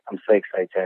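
understated, calm excitement; not sounding excited. Real, not acted. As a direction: say you're excited without sounding it. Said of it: "you don't sound as excited as I am".

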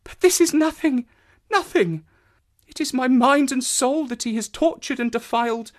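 high, distraught female voice, understated sobbing; near-sobbing anguish. A narrator's reading. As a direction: pour out the hurt, close to tears. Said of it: "broke into passionate sobbing as she spoke".

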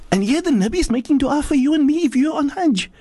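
high-pitched, emphatic preacher; impassioned preaching. Acted. Preacher exhorting pilgrims.

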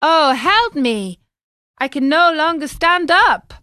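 loud high pleading; desperate plea. Acted. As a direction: beg for help as legs give way. Collapsing, begging for help.

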